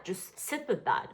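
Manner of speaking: softly spoken, sober prompt; quiet, earnest therapy voice; serious therapist. Real, not acted. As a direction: let it sink in, quietly. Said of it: "such a therapist".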